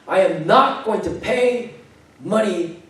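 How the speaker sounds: high, loud mock tirade, hitting the key words; screaming husband. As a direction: imitate an angry husband yelling in public. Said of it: "he's, like, screaming at her".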